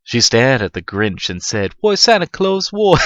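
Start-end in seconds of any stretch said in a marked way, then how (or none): 1.8-3.0 put-on high, loud little girl asking; squeaky small girl pleading with Santa; child voice. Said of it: "This doesn't sound right".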